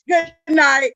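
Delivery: high, loud and laughing; laughing good night. Real, not acted. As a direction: sign off through a laugh. Laughing sign-off.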